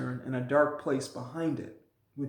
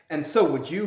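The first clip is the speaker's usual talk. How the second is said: louder voice; 2 semitones higher, 7 dB louder than usual. A narrator's reading. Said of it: "much louder voice".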